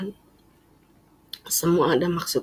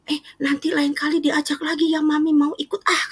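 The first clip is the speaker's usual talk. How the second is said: higher imitated mother, coaxing; eager mother; 10 semitones higher than usual. Mother asks to come again, high and eager. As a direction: sweetly ask to be invited again. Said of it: "katanya gitu".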